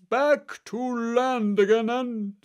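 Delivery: high, loud, slow-stretched call; excited yell. A narrator's reading. Gryphon racing through the dance figure, high loud yell. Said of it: "at the top of its voice".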